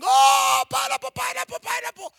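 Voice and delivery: high, loud hawker's cry; street vendor call. Retold or imitated. Peddling pineapples in the Nakuru street, loud vendor cry. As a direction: call out your pineapples to passers-by. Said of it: "And I would shouting".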